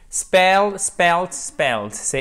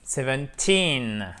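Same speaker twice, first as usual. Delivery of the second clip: put-on seventeen, slow, stress on the last syllable "teen"; much slower than usual. Shown as a demonstration. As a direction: stretch out seventeen, landing on "teen". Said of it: "Ça se prononce avec l'accent tonique".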